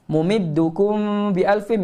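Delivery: slow chanted tajwid recitation; Quranic recitation. Shown as a demonstration. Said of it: "Ikhfa syafawi".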